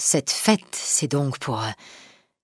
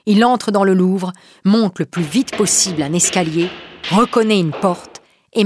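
usual speaking voice

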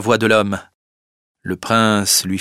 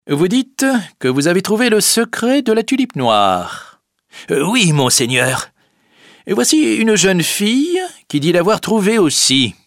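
higher, theatrical exchange; character voices; 10 semitones higher, 4 dB louder than usual. Acted. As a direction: play the confrontation. Prince and Boxtel dialogue.

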